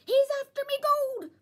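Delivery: loud, high, fast panicked cry. A narrator's reading. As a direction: blurt it out in alarm. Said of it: "I cried".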